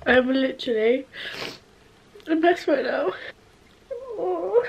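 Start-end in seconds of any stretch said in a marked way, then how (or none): 4.2-4.6 tearful gasp. Real, not acted. Suddenly tearing up while packing.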